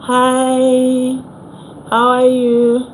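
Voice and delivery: usual speaking voice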